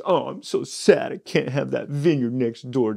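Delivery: hammed-up self-pity like a pouting child; big crybaby. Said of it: "Just big crybaby".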